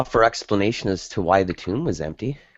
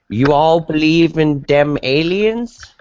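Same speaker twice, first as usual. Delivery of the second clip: slow, raised-pitch imitation of a Southern American caller; drawn-out mock Southern US twang, louder; Southern US accent; 9 semitones higher, 5 dB louder, slower than usual. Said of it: "a middle American Southern accent".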